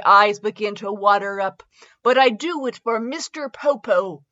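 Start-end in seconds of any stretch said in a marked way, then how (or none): none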